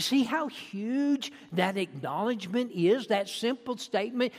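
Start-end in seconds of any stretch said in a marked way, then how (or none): none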